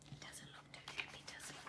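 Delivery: breathy unvoiced whisper. Real, not acted. Aside to listeners while reading.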